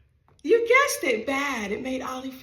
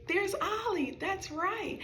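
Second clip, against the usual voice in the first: excited; 9 semitones higher than usual. Real, not acted. Excited reveal to children.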